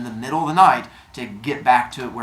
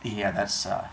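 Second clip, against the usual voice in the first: low, soft, hesitant confession; reluctant admission; 5 semitones lower, 8 dB quieter than usual. Retold or imitated. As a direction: own up sheepishly under your breath. Reluctantly claims car to police.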